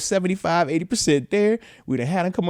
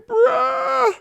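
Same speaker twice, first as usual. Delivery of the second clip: exasperated shout. Retold or imitated. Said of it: "I'm like".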